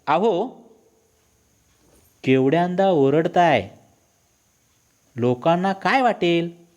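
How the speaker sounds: a touch of nagging reproach; wife's scolding. Acted. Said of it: "अशी माझी बायको दमदाटी मला करायची".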